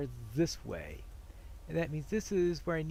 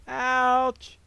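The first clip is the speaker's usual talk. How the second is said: a sudden high, loud yelp; ouch; 7 semitones higher, 7 dB louder than usual. Real, not acted. Gamer reacting to a troll hit, loud yelp. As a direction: cry out as the hit lands.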